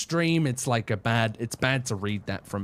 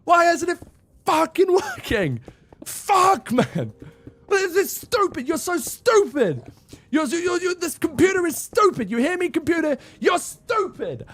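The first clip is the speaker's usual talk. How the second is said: much higher, louder mock screaming at the computer; acted crash out rage; 4 dB louder than usual. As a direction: rage at a broken computer, hammed up. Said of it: "I'll just start crashing out, and I'll just be like".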